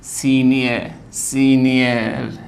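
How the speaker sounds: सीनियर slowly, long सी, final र barely sounded; fading final r. Shown as a demonstration. Teacher demonstrates silent R to students, fading final r. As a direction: draw out सीनियर and let the र die away. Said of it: "ऐसा लगेगा रे बोला है लेकिन".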